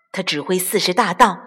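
ordinary delivery

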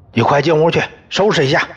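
stern order. Acted. Father orders daughter inside.

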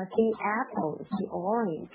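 THE with a long ee before the vowel; THE as "thee". Shown as a demonstration. Teacher demonstrating the sound change before vowels, THE as "thee". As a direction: say THE as "thee" before apple and orange. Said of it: "它是会变成D".

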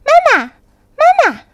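put-on high, loud, drawn-out child's call; hurried calling out. A narrator's reading. Tadpoles hurry after the turtle, calling high, loud and drawn out. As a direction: cry out slowly and high after her. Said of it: "连忙追上去叫道".

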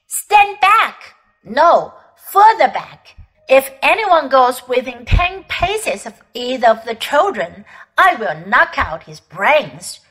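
menacing witch shouting orders; angry threat. Acted.

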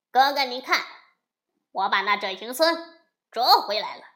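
loud, quick proud report to big brother; loud gloating younger brother; boasting demon. A narrator's reading. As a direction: crow about the catch. Silver Horn brags to brother.

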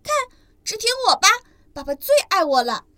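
a proud tiny ankylosaur; proud baby dinosaur. Acted. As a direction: crow that dad loves you best. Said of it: "尼骄傲地说".

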